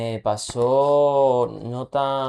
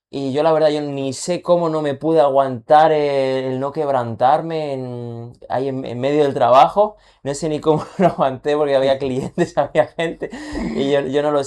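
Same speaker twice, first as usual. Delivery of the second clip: overwhelmed, laughing; 7 dB louder than usual. Retold or imitated. Overwhelmed at work recalling.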